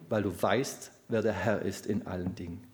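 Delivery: usual speaking voice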